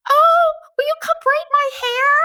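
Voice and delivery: put-on high, sweet little-girl voice; imitating the girls. Imitating the theater girls asking her, high and sweet. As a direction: ask it like an eager young girl. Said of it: "They were like".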